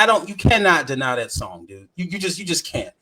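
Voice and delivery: ordinary delivery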